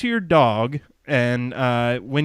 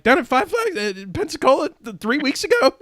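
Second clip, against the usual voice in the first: much higher, eager checking question; excited disbelief; 10 semitones higher than usual. Retold or imitated. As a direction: bubble over like a fan who knows the race. Retelling own excited reply.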